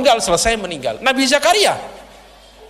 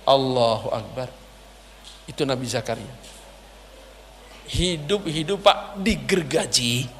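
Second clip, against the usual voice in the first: hushed grief; 10 semitones lower, 7 dB quieter than usual. Real, not acted. Preacher tells the congregation of the sawing in a hushed voice. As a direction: lower the voice in awe and grief.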